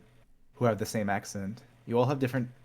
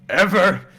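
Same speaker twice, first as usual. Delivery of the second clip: Ever said higher and louder, falling; loud insistent ever; 8 semitones higher, 14 dB louder than usual. A narrator's reading. Narrator vowing never to forget, loud insistent. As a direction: slam down on Ever. Repeated building word.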